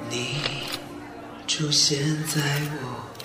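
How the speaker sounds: ordinary delivery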